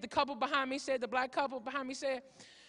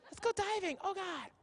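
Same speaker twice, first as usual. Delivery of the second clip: high, soft, excited couple voice; movie honeymooner; 5 semitones higher, 6 dB quieter than usual. Acted. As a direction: gush softly in a high voice. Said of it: "this is the whole movie right here".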